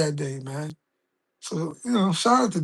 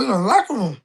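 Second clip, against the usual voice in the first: ordinary talk, nothing marked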